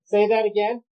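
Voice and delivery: talking through respirator and face shield; muffled behind a mask. Real, not acted. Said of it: "my voice is gonna get muffled 'cause I'm gonna throw this on".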